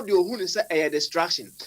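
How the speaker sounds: ordinary talk, nothing marked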